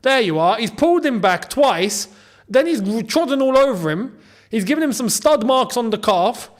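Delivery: worked-up football fan making the case; animated argument. Real, not acted. Protesting a foul during the match.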